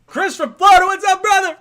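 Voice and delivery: very high, loud greeting shout; excited yelling. Real, not acted. Host greeting a caller from Florida, excited yelling. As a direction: holler hello to a fellow fan at full volume. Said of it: "if I'm yelling into the mic".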